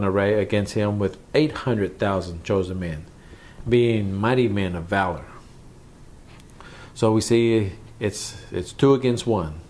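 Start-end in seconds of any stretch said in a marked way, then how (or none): none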